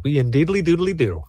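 lightly voiced folksy neighbor; chirpy neighborly singsong, lightly done; Ned Flanders impression. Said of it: "Not the Flanders".

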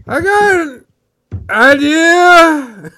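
loud, high yell from the back room; shouting from the shower. Retold or imitated. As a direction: belt it out high from behind a closed door. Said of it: "You hear from, you hear from the back".

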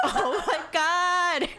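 high, drawn-out gasping laugh; re-enacted delighted shock, slow and high; gleefully shocked reaction. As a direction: act scandalized, stretching it out high. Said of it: "And I was like".

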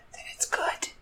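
soft whispered aside. Real, not acted. Admitting to the listener that bread makes it good, whispered. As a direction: confide it in a whisper.

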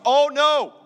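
mock dismay. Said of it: "So he goes".

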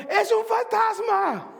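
high, slow, terrified cry; frightened shout. Retold or imitated. Disciples in the boat cry out in terror, high and slow. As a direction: cry out like you've seen a ghost.

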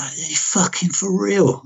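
slow, high-pitched incredulous question; retold exasperated disbelief. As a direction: drag it out, pitch up, unable to believe it. Retold disbelief at the doctor's answer.

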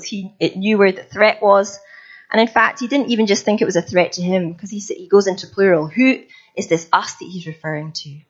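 ordinary talk, nothing marked

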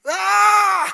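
very loud, high, sustained mock scream; hammed-up long shriek of pain; imitated pain scream. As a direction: scream at full volume as if in agony, play-acting. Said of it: "Empezó a gritar".

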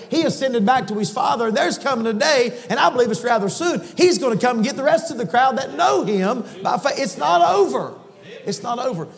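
loud, high-pitched climactic preaching; preaching at full force. Acted. Preacher shouting hope to the congregation, full-force preaching.

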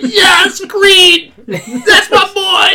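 high, loud tearful cheer; cheering shout. Retold or imitated. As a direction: yell at the screen, choked up.

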